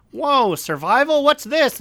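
high, excited whoa of discovery; surprised curiosity. Retold or imitated. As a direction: act amazed by something new. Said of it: "being like".

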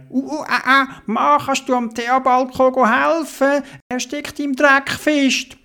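high strained heaving voice; Dodo straining to pull. Acted. Dodo pulls Theobald from the mud, high and straining. Dodo asks crane man.

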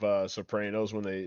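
usual speaking voice

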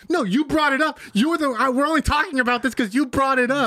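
high-pitched, indignant imitation; mock protesting retort. Friend retorting when accused of bringing up race, mock indignant protest. Acted retort in retold argument.

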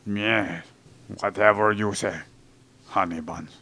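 low, soft placating husband; meek henpecked husband. Pretend. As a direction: sigh and give in to her. Mock spouse banter.

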